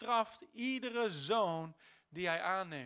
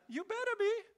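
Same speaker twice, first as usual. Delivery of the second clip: teasing challenge; 12 semitones higher, 4 dB louder than usual. Acted.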